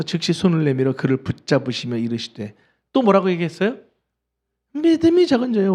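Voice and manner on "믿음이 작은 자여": 믿음이 called out high, as a sharp cry; a high, ringing cry of reproach; Jesus catching Peter as he sinks, calling out high; high exclaimed rebuke. Retold or imitated.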